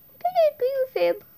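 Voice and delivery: put-on high teary child voice, laughing through tears; crying little character. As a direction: sob it out like a tiny scared kid. Same tiny character defending himself.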